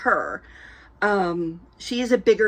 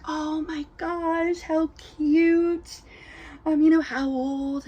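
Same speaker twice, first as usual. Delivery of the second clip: gushing gentleman; 7 semitones higher, slower than usual. Retold or imitated. Gentleman admiring the baby.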